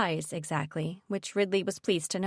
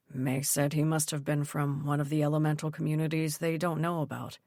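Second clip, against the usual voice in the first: father voice; 4 semitones lower than usual. Acted. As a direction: voice the calm father, low. Narrator voices Dad.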